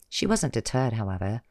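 usual speaking voice

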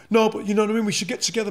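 hammed-up brother pushing to get together; mimicking the brother. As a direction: parrot his brother's pleading with a sneer. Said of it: "when he died".

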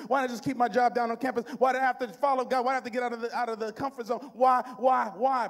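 loud, mildly panicky cowardly lion; half-preached cowardly lion, repeating in fright. Acted. Preacher imitating himself balking at God's call, cowardly lion. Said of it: "sounding like the, the, the cowardly lion".

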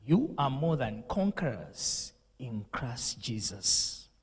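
usual speaking voice

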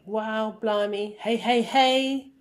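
mock-excited wildlife presenter, rising to a loud hey; excited exclamations. Shown as a demonstration. Teacher demos presenter exclamations for class. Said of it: "exclamations like".

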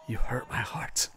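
hushed mock-hurt whisper, slow. Streamer answering mean chat, whispered mock hurt. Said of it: "lower my head and be like".

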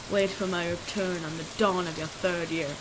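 low, hushed spirit voice; quiet, low ghostly pronouncement; ominous spirit. Acted. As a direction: deliver a soft, deep prophecy. Reads a spirit's message aloud.

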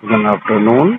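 mock greeting. Prank caller echoes the LIC agent's greeting, mock low voice. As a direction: parrot her greeting back in a put-on low voice.

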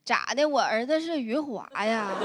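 ordinary talk, nothing marked

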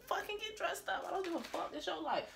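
soft offhand dismissal; throwaway aside. Real, not acted. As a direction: toss it away with a shrug.

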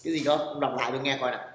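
usual speaking voice

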